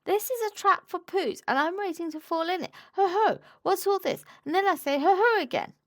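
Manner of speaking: high flustered gabble; quick and squeaky. Acted. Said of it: "very quickly and squeakily".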